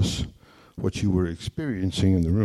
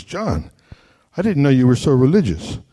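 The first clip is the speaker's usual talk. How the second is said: put-on amazed girlfriend; 6 semitones higher, 7 dB louder than usual. Girlfriend amazed at long prayer.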